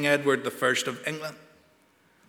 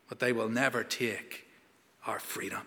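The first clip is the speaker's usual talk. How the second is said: much softer, a dropped intense finish; hushed climax; 6 semitones lower, 10 dB quieter than usual. Retold or imitated. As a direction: let "freedom" fall to a near-hush.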